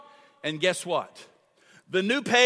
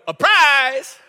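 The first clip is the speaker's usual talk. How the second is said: grandson shouting; 6 semitones higher, 9 dB louder than usual. Retold or imitated. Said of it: "he'll go".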